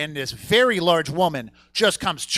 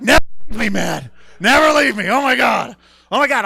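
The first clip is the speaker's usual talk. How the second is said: loud, high gushing; excited; 7 semitones higher, 8 dB louder than usual. Retold or imitated. Thrilled at his buddy's alligator news, loud. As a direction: gush with thrilled delight. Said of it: "I'm like immediately excited".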